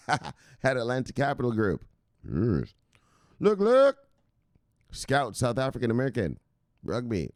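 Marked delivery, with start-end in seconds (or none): 3.4-4.0 loud, high "look, look"; pumped-up shout; hyped catchphrase. Real, not acted. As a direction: shout it out like a hype man.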